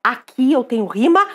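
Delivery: usual speaking voice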